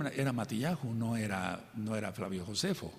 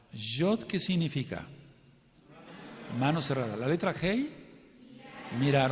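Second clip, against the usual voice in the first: usual speaking voice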